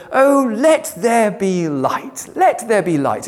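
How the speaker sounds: slow, drawn-out dreamy "Oh, let there be light"; gently sarcastic, lingering recital; mock lovely reading. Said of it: "so superfluous and lovely".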